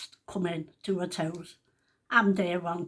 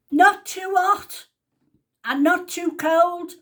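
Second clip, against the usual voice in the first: high, loud and slow call; shouted through; 9 semitones higher, 8 dB louder, slower than usual. Retold or imitated. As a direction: bellow it slowly from the other room. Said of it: "She shouts through to me".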